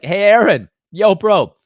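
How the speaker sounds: high, loud, goading party-bro call. Acted. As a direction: egg Aaron on like a rowdy buddy. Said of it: "And they're like".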